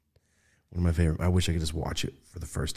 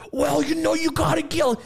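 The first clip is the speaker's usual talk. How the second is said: loud, high East Coast accent impression; Peter Dante; 5 dB louder than usual. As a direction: belt it out in exaggerated East Coast. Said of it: "his East Coast".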